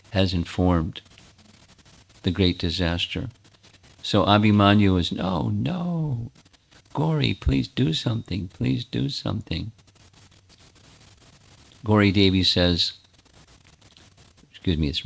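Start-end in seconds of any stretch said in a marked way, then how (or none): 5.1-9.7 higher, softer, frightened pleading; terrified husband begging the goddess; panicked begging. Acted. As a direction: beg for your life in a thin voice. Abhimanyu begs deity in panic.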